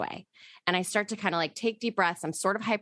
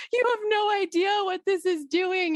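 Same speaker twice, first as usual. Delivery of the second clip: much higher, breathless gush of disbelief; overwhelmed gushing; 13 semitones higher, 3 dB louder than usual. Retold or imitated. Texting a friend about the book, high overwhelmed gush. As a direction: squeal it out like the news is too much. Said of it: "And I'm like".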